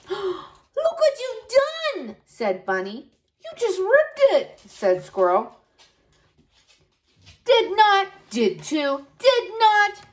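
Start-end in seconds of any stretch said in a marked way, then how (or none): 0.1-2.2 dismayed. Acted. Torn letter dismay. 3.4-4.6 accusing. Acted. 7.4-10.0 high petulant back-and-forth; childish bickering. Acted. Bickering quarrel.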